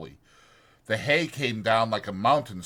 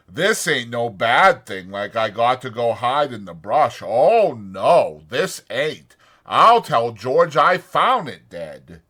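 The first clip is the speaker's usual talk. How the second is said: loud, anxious simple man; Lenny's worried voice; 9 dB louder, slower than usual. A narrator's reading. Lenny frets to himself over the dead puppy, loud and anxious. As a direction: fret out loud about George finding out.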